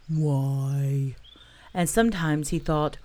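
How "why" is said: sad sigh. A narrator's reading. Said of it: "he thought sadly".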